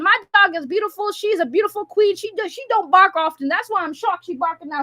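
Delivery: ordinary talk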